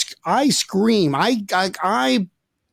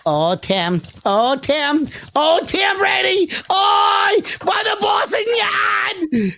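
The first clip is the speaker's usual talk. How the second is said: building to a high, shouted Boston-fan caricature; mock New England accent; much slower than usual. Shown as a demonstration. Host mocking New England fans, shouted Boston accent. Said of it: "New England fans. I'm gonna be like".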